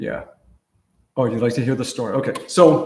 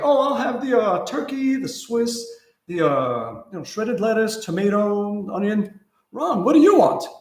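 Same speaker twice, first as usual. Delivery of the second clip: higher, breezy sandwich order; lightly voiced student; 6 semitones higher than usual. Student ordering at Subway, then teasing him, higher voice. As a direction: order like a cheerful young student.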